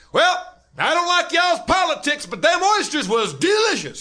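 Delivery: loud, high Southern yokel voice; loud Southern drawl. Retold or imitated. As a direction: belt it out like a Southern good old boy at the bar. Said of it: "I'll do it as loud as he did it".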